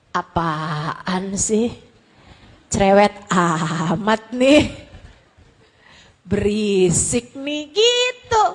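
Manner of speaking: slow, drawn-out irritated complaint; annoyed grumbling. Acted. Preacher acting out annoyance at a dull prayer, irritated. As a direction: act fed up with noisy nagging, stretching the words.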